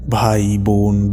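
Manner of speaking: ordinary delivery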